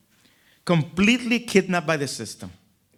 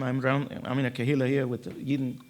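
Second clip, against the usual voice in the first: ordinary talk, nothing marked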